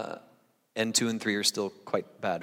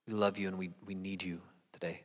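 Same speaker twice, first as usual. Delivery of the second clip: fading into a whisper; whispered prayer; 3 semitones lower, 9 dB quieter than usual. Real, not acted. Pastor closing his prayer to God in a whisper. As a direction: breathe the last words to God. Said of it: "prayer fades to whisper".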